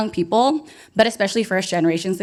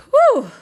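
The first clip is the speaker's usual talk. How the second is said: high, loud relieved whew; 7 dB louder than usual. Real, not acted. MC exhaling after the crowd screams. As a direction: blow out the breath. Catching breath after hype.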